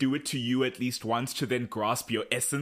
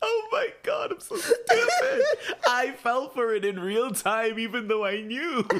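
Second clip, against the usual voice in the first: laughing at herself, much higher; laughing hard; 10 semitones higher than usual. Real, not acted. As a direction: crack up at yourself for falling for it. Laughing at own gullibility.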